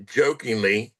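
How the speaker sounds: usual speaking voice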